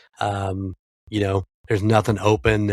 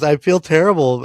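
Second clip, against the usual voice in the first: higher, louder sheepish confession; guilty grandson; 7 semitones higher, 6 dB louder than usual. Retold or imitated. Grandson confessing how bad he feels, higher and louder. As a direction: blurt out the guilt. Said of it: "He goes".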